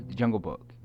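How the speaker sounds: ordinary talk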